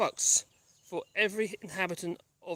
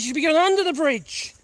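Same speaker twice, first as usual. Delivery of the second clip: calling out; 8 semitones higher, 7 dB louder than usual. Real, not acted. Calling dog from distance.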